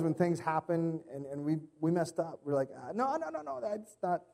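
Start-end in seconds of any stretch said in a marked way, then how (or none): 2.8-4.2 higher, pleading "no, no" of someone caught out; back-pedal fast in a flustered voice; mock panicked denial. Caught messing up, a mock flustered denial.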